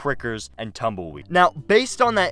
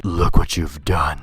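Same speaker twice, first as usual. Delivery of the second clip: menacing teacher voice; 9 semitones lower, 3 dB louder, slower than usual. Retold or imitated. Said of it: "stares daggers into my eyes like she was trying to say".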